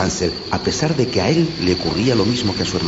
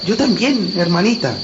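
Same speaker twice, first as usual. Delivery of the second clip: put-on high child's voice, admitting fear; a boy owning up he's scared too; young boy voice; 12 semitones higher, 4 dB louder than usual. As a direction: brother to his sister, high and gentle. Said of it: "reconoció el muchacho".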